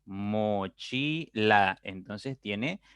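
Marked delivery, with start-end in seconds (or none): 0.0-1.8 very slow, syllable by syllable; split into syllables. Shown as a demonstration. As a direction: mochila broken into mo-chi-la, each beat separate. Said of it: "pronuncio pausadamente cada sílaba".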